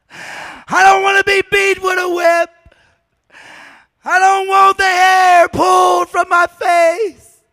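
loud, high, slow anguished cry to the Father; Jesus crying out in Gethsemane; Jesus pleading. Acted. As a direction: beg for the cup to pass, crying out. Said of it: "He's gonna say".

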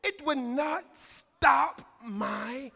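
loud, slow, drawn-out proclamation; full-force preaching. Real, not acted. Preacher declaring worship rests on hunger for God, full-force preaching. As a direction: hammer each phrase, pausing between bursts.

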